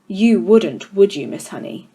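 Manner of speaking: a hint of a contemptuous headmistress; stern headmistress. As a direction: loom over the class, mildly menacing. Trunchbull mocks Miss Honey.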